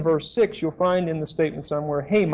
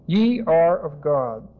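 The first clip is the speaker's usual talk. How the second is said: "Ye" lengthened and stressed in a slow reading; slowly, putting the weight on "Ye"; emphatic stress on Ye; slower than usual. Shown as a demonstration. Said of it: "And it's very emphatic".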